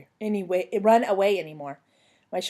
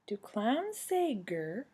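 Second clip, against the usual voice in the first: slow, softer, doubtful question; puzzled question; 3 semitones higher, 6 dB quieter, much slower than usual. A narrator's reading. Cinderella asking fairy godmother about clown, puzzled. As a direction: ask it slowly, unimpressed. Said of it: "she asked".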